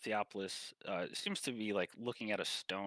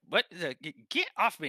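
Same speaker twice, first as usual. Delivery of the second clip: high, louder squawk of protest; indignant yelp; 7 semitones higher, 9 dB louder than usual. Acted. Tiny captain yelping at being scooped up like a child, high and loud. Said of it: "And he's like".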